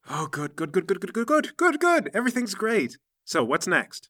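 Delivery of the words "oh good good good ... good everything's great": repeated words climbing to a high squeak; panicky relief. Acted. Hearing the plan mostly works, panicky relief climbing high. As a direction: babble reassurance, pitch rising steeply as you go.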